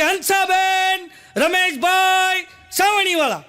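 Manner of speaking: high, slow, loud announcing yell; loud drawn-out shout. Retold or imitated. Neighbour bellows their names at the door at night, high, slow and loud. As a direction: bellow each name slowly. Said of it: "એટલું બધું જોરથી બોલ્યું ને".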